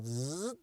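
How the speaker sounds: rising "zzz" of a seat motor; motor ramp-up buzz. Retold or imitated. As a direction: imitate a motor smoothly gaining speed. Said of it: "they ramp up to speed".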